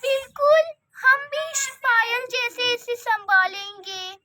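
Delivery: fake sweet. Aunt fakes sweetness before husband.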